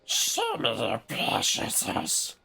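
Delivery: high gravelly creature voice, slow; Golem voice. Pretend. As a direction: rasp and whine like a cave creature. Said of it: "I could do that one a little better".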